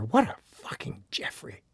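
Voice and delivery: bitter whispered self-insult. Retold or imitated. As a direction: whisper it to yourself in disgust. Self-reproach, inner thought.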